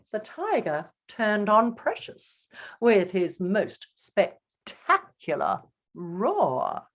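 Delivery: ordinary delivery, nothing marked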